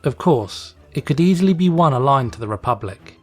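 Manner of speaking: plain reading voice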